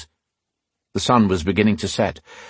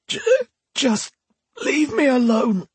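much higher, strained plea; anguished Alesh. Acted. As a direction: push the vision away. Alesh voice high.

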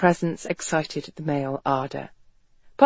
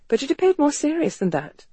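plain reading voice

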